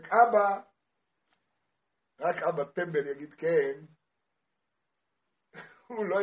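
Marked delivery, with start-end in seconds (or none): 0.0-0.6 loud, higher call for a father; child crying out. Retold or imitated. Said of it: "צועק". (צעקת ילד לאבא)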